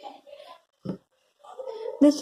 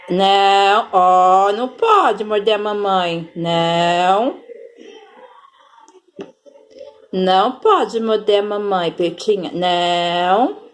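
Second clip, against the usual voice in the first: lower, firm no-biting warning; stern scolding; 11 semitones lower than usual. Real, not acted. Mother scolding the cat for biting, low and firm. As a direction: tell the biting cat off, firmly.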